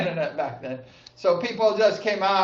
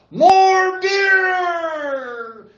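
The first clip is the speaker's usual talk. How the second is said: high, drawn-out rallying yell; imitating crowd-rouser's yell; 11 semitones higher, 4 dB louder than usual. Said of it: "he would, like, yell".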